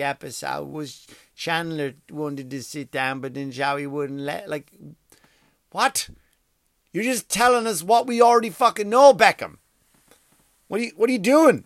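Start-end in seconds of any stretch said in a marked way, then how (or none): 5.7-11.6 higher, louder incredulous rant; high, loud, incredulous exasperation; exasperated outburst. Real, not acted. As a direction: yell at him in disbelief.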